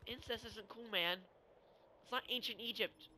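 usual speaking voice

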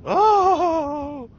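long, high, loud mock moan; imitated moan. Storyteller imitating another churchgoer going down, high loud moan. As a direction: wail out a drawn-out "oh", imitating someone overcome.

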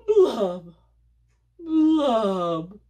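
loud, high, slow mopey fish voice; sing-song self-pity of a glum fish; gloomy pout fish. Acted. Pout pout fish moans his refrain, loud and high.

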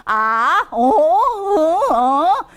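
아 and 어 drawn out, swooping down and up; third-tone dip. Shown as a demonstration. Teacher illustrates the easy tone, long swooping 어. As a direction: stretch 어 through a slow dip and rise. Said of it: "그냥 한국말 고, 고 발음 위치로".

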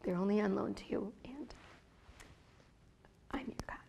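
soft, whispered voice of God; hushed God voice. Retold or imitated. God softly reminding her they are His.

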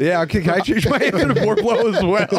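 high, laughing question; giggling through the joke. Real, not acted. Joking answer change, laughing.

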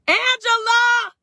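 high, drawn-out delighted call; calling out a greeting. Real, not acted. Greeting an auntie who joins the live stream, calling out. Calls out greeting to arriving aunt.

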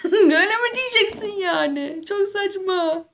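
can barely speak for laughing. Real, not acted. Laughing to viewers about the open toilets.

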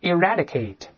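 "eradicate" with the æ vowel in "rad"; model pronunciation. Shown as a demonstration. Teacher has students repeat the word, clearly modelled "eradicate" with æ. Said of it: "又是梅花音".

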